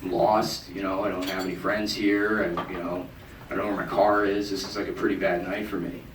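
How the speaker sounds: low, soft, defeated confession; a quiet mock breakdown; breaking down. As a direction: sink into it like a lost drunk finally admitting it. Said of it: "I, like, sort of broke down".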